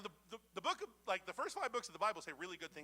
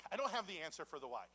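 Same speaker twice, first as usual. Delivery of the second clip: ordinary talk, nothing marked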